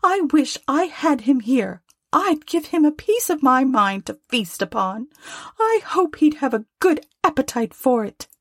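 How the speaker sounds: high-pitched scolding wife; high, tart indignation; indignant Mrs Cratchit. A narrator's reading. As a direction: rail at the absent boss in a high voice. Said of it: "cried Missus Cratchit, reddening".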